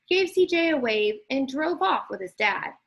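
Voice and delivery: usual speaking voice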